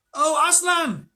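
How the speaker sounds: high, loud joyful cry; cried out. A narrator's reading. Edmund finally sees Aslan and cries out in joy. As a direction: burst out at seeing him. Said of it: "he cried".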